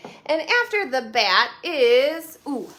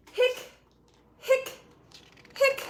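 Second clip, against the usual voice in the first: hiccuping; 13 semitones higher, slower than usual. Acted. Voicing skeleton's hiccups.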